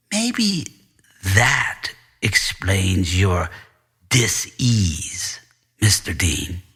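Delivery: a hint of a low, sly principal drawing it out; slow and knowing, savouring the word; lightly voiced principal. Principal teasing the bruised student, low and sly. Principal Doctor Vine speaking.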